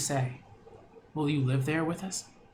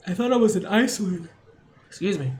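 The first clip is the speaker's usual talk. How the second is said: voiced character line; 4 semitones higher, 5 dB louder, faster than usual. Reading game character line aloud.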